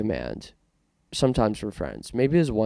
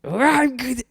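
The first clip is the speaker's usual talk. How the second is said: shrieking, much higher and louder witch cackle-voice; Wicked Witch impression; 5 dB louder than usual. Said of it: "Wicked Witch of the West".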